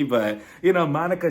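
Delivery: ordinary delivery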